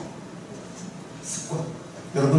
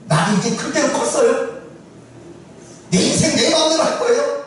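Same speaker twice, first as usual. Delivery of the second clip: higher-pitched, mildly defiant retort; a touch of youthful rebellion; acted defiant child; 6 semitones higher than usual. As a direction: talk back to a parent, lightly acted. Child talks back to father.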